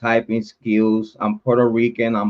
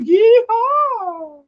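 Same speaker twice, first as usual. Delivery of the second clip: much higher, whooping cry; mock yeehaw. Streamer whooping at his doubters, high mock yell. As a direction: let out a hammed-up cowboy whoop. Mocking whoop after taunt.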